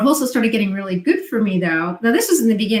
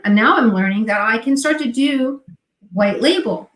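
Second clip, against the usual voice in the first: ordinary talk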